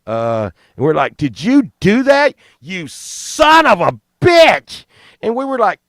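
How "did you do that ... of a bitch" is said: higher, louder, slow accusing yell; drawn-out mock rage. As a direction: bark it at your buddy in mock outrage. Friends accusing Steve.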